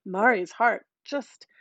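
usual speaking voice

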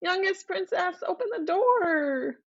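high, pleading frog calling through the door; crying frog voice. A narrator's reading. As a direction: wail up high like a little frog begging. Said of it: "the voice cries".

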